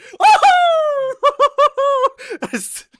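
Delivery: very loud, high shrieking laughter; long taunting cackle, acted out at full volume; mocking laugh. Acts out laughing at brother.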